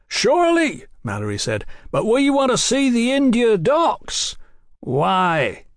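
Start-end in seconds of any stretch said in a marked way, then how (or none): 0.1-0.9 high-pitched call back; high, hearty shout. A narrator's reading. Calling up to men on wall. 1.9-4.4 high-pitched call back; high, hearty shout. A narrator's reading. Calling up to men on wall. 4.8-5.6 cold, curt question; chilly one-word challenge. A narrator's reading. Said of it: "The word was cold".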